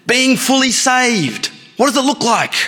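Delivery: high, slow, eager man voice; cheerful jumper. Acted. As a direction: play the smug rescuee, drawn out. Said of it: "he says".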